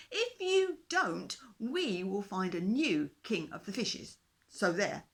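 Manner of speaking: little fishes warn their king, mildly cross; lightly voiced cross little fishes. A narrator's reading.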